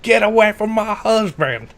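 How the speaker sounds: high, loud Aussie shout; angry Australian wife. Acted. Jealous wife yells at the flirting woman, loud Australian shout. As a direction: warn her off your husband, loudly.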